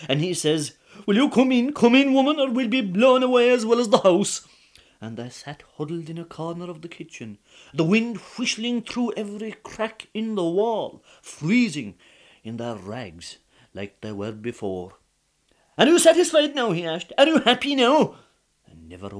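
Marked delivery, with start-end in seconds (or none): 1.0-4.4 high, loud urgent shout; husband bawling at his wife in the storm; urgent call. Acted. 15.8-18.1 high, loud accusing demand; ruined husband reproaching his wife; bitter reproach. Acted.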